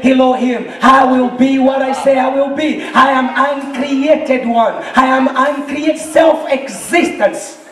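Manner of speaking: full-force preaching. Real, not acted. Preacher declaring that God is real and alive, full-force. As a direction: hammer each declaration home with fervor.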